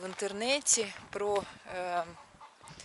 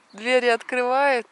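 ordinary delivery

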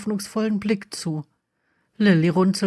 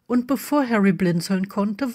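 ordinary delivery, nothing marked

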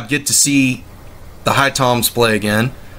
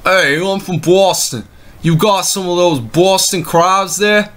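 slow, high exaggerated Boston accent; half-brother's Boston accent; 5 semitones higher than usual. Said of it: "my impersonation of my half-brother".

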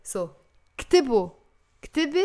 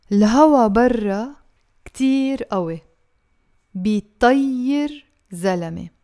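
reading aloud; 5 dB louder than usual. Shown as a demonstration. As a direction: read the passage clearly for learners. Reads passage aloud.

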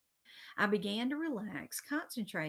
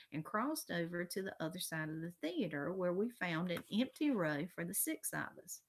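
usual speaking voice

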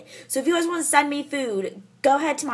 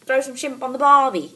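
hammed-up Australian accent; 2 semitones higher, 5 dB louder than usual. Shown as a demonstration. Vlogger imitating Australians for viewers, mock Australian accent. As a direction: put on a stock Aussie greeting. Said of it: "that's Australian".

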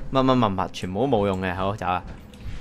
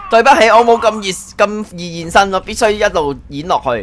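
theatrical villain voice; 8 semitones higher, 3 dB louder than usual. Acted. Dubbing the villain's game lines.

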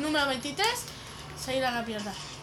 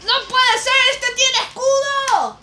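shocked disbelief; 8 semitones higher, 7 dB louder, slower than usual. Real, not acted. Shocked the enemy has shield.

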